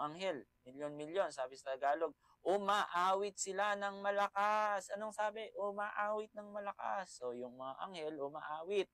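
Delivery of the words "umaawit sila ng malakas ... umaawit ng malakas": insistent objector. Acted. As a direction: stretch the misquoted verse out on a raised pitch. Mimics misreading that angels sing loudly.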